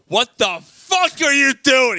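high, loud, drawn-out mock outrage; angry confrontation. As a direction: imitate an angry woman demanding an answer. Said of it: "No, she goes".

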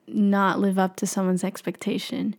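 ordinary delivery

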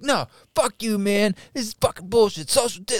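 high-pitched imitated tirade; hammed-up furious rant; going off. Storyteller voicing husband going off on the phone guy, mock tirade. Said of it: "going off on this dude".

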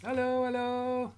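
a little bug shyly saying hello; ladybug puppet voice. Acted. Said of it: "the ladybug is coming".

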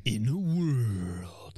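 deep, slow epic trailer voice; movie trailer voice. Shown as a demonstration. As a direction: intone it like a film trailer. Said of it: "the deep, groggly voice, like a Lord of the Rings movie".

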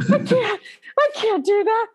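high, panicky pleading; panicked protest. Retold or imitated. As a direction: beg to get out of it, voice jumping up. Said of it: "I'm like".

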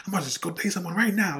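high-pitched burst of retold excitement; excited. Retelling being on top of the world, mock-excited outburst. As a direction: re-enact being carried away, pitch leaping up. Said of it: "I got so excited".

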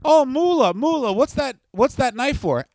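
put-on high innocent little-girl voice; hammed-up pampered princess; naive rich girl. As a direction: ask sweetly, wide-eyed and clueless. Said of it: "Stephanie voiced naively".

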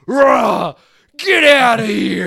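drawn-out, high, loud roar; mock battle roar. Acting Jackson throwing him out in slow motion, drawn-out roar.

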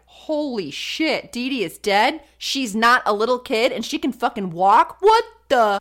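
stunned disbelief. Retold or imitated. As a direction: imitate hometown folks hearing the news.

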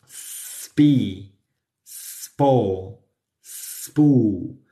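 spe spa begun straight on the s; syllable drill. Shown as a demonstration. Teacher drilling s plus p syllables. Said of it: "Lo mismo con la P".

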